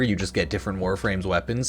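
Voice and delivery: normal talking voice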